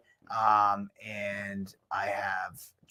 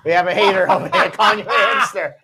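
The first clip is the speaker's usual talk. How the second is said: laughing shout; 9 semitones higher, 6 dB louder than usual. Real, not acted. Host teasing, reading hater comment.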